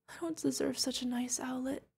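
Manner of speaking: soft, high, fragile voice; tearful dread. Acted. Guardian watches owlet not healing, quiet distress. Fears the kid is dying.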